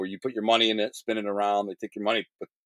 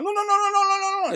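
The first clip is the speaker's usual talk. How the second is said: high-pitched, rapid-fire mock panic; hammed-up high frantic pleading; freaking out. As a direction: imitate a man pleading in panic. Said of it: "he was freaking out".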